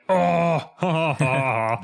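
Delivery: sarcastic ha-ha-ha, spelled out; fake laugh. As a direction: laugh it off mockingly. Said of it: "Which I was like".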